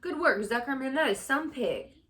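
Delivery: high, loud excited shout; cheering. Acted. Crowd celebrates the pig's recovery, loud excited cheer. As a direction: shout it with joy.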